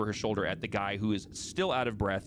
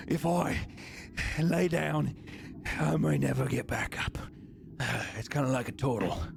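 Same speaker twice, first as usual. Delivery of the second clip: winded heavy guard; 4 semitones higher than usual. Acted.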